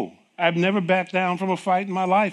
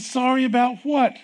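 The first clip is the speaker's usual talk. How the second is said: high, louder, startled ask; alarmed question; 8 semitones higher, 4 dB louder than usual. Retold or imitated. Patient pressing her doctor about the apology, high and startled. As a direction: demand the news, pitched up.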